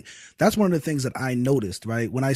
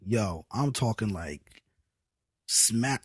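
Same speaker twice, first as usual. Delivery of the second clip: ordinary delivery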